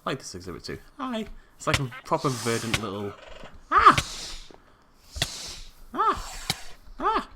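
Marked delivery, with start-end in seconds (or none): 3.7-7.3 high panicked yelps; startled screams. Real, not acted. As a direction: shriek as something lunges at you. Attacked by dinosaurs.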